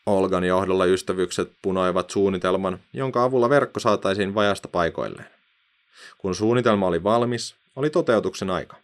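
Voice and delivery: plain reading voice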